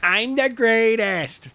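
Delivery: hammed-up braggart crowing about himself; mock boast. Voicing Danny bragging.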